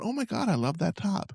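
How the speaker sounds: lightly voiced, high, soft gushing; Lamar. Podcaster imitating Lamar admiring a top, lightly gushing. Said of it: "you hear Lamar going".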